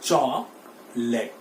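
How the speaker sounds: chocolate said as chok-let, two syllables, very slowly; correct pronunciation. Shown as a demonstration. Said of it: "फिर से मेरे साथ बोलिए". (सही उच्चारण, चॉकलेट, धीरे)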